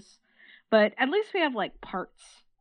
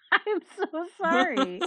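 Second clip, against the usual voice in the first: laughing; 7 semitones higher, 4 dB louder, slower than usual. Real, not acted. Laughing apology after spoiler.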